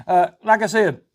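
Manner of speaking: usual speaking voice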